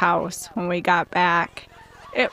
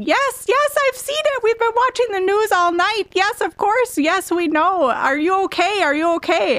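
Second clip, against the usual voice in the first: rapid-fire high-pitched worried outburst; retold frantic screaming; 12 semitones higher than usual. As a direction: imitate a frantic mom in a high, urgent squeal. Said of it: "she just starts screaming".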